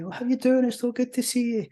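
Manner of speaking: mock friendly greeting, pitched high; voice pitch going up. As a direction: exaggerate a high-voiced hello. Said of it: "Your voice pitch may go up".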